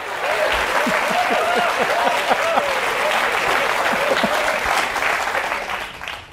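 long high unvoiced laugh. Real, not acted. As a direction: laugh with the crowd. Audience reaction.

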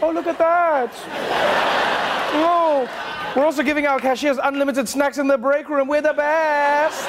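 high, gloating mock corporate voice; smug Amazon.

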